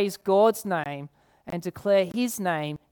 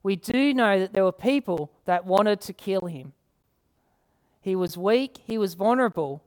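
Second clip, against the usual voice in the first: ordinary delivery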